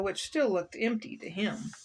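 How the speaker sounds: plain reading voice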